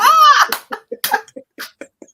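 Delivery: loud, high burst of real laughter. Real, not acted. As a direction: let out a big real laugh. Loud laugh after joke.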